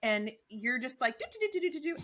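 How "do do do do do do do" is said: high, chirpy mock humming chatter; Tracy. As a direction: trill along like an oblivious, cheery friend. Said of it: "You're just being Tracy".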